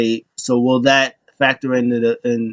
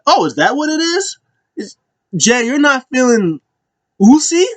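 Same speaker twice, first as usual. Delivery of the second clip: high-pitched, amused mock disbelief; incredulous questioning; 12 semitones higher than usual. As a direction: imitate someone tickled and incredulous, pitch way up. Acting out Roman's amused disbelief.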